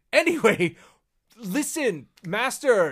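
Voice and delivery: high, loud, hammed-up appeal; Maul grovelling. Maul turning back to his master, grovelling. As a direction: hurry back to buttering up the master.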